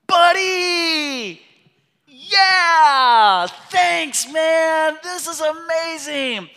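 high, loud, over-bright thanks, clearly faking it; forced delight. Retold or imitated. Wife thanking son for burnt breakfast, hammed-up delight. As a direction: gush like the food is wonderful when it isn't.

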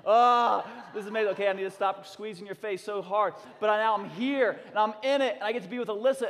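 high, loud, overflowing joy; elated. Real, not acted. Father reliving hugging his kids, loud and elated. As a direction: gush as if squeezing them close.

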